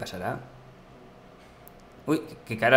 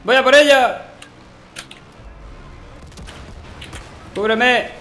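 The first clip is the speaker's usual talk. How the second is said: urgent shout; 6 semitones higher than usual. Real, not acted. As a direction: shout it as you charge. Rushing out under fire.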